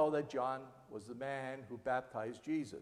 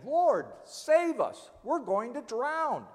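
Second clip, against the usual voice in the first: higher, louder panicked plea; terrified cry; 7 semitones higher, 5 dB louder, slower than usual. Acted. Disciples waking Jesus in the storm, panicked cry. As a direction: cry out as if the boat is sinking.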